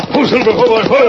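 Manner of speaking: high ringing horse call. Acted. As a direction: rein in the stallion with a shout. Rider calls to his horse.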